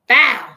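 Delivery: loud, sudden mouth crash; mimicked thud of a branch hitting her shoulder; crash noise. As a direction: smack the sound out like a falling branch.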